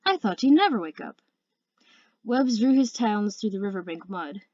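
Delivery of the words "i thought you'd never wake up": high, lilting pleasant greeting. A narrator's reading. Sea Wing finds Webbs in the forest, pleasant and lilting. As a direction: greet pleasantly with big swoops in pitch. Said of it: "in a pleasant voice".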